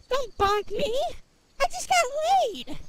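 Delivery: high, loud playful protest; gleeful squeal. Acted. Playful boast after the love scene.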